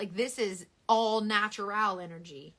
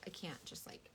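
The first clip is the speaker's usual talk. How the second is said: quiet aside; 4 semitones lower, 17 dB quieter than usual. Real, not acted.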